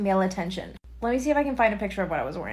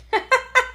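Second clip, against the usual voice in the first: laugh; 13 semitones higher than usual. Real, not acted. Laughing at his reaction.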